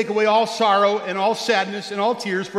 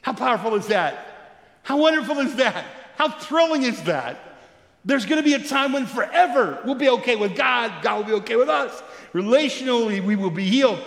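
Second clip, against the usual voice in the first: preaching; 4 semitones higher than usual. Acted. Pastor rousing the congregation, preaching at full force. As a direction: lift the room with each question.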